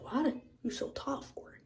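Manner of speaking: hushed, up-high whisper; awed little whisper; whispered question. Retold or imitated. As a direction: whisper it up at someone tall. Asking the tall man in dream.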